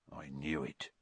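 low, very soft murmur; hushed, under the breath. A narrator's reading. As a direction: murmur it darkly to yourself. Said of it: "he murmured".

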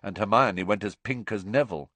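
ordinary delivery

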